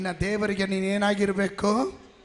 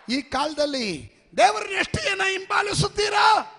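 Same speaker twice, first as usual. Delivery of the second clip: high, ringing question to the crowd; preaching call; 11 semitones higher than usual. Acted. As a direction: call it out over the church. Preacher calls to congregation. (ಸಭೆಗೆ ಎತ್ತರದ ಧ್ವನಿಯಲ್ಲಿ ಕೂಗು)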